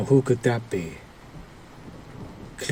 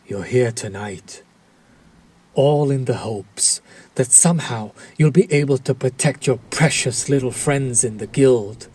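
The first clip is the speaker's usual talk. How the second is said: ordinary talk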